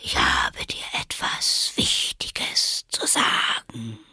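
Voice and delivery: slow, whispery drawl; sleepy caterpillar. A narrator's reading. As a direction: announce it drowsily, half whispered. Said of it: "mit schläfriger, langsamer Stimme". (langsam und schläfrig gehaucht)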